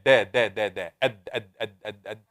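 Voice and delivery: د struck short with the tongue tip, again and again; sounding out dal. Shown as a demonstration. Teacher models دال for the students. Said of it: "Raise your hand and try".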